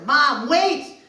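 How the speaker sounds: high, loud desperate calling. Retold or imitated. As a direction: shout after her to hold on. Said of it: "I'm calling out loud to my mother".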